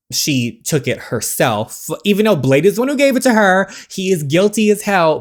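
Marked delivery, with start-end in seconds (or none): 2.0-5.2 loud, high, fast rant; heated rant. Real, not acted. Player rants that Blade is guilty, heated. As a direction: pile on the blame.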